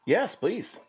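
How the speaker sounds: high eager yes, please. Pretend. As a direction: jump at the offer, high. Eager mock reply.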